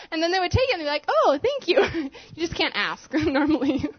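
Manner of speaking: high, breaking into laughter mid-sentence; laughing. Real, not acted. Telling the church about mom's trick, laughing. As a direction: laugh through the words.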